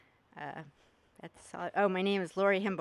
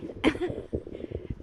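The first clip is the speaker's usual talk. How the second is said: nervous laugh; 8 semitones higher than usual. Real, not acted. Nervous laugh starting her speech.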